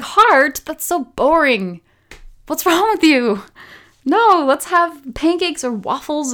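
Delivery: whiny protest. Retold or imitated. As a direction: whine at your heart like a kid denied treats. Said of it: "I was like".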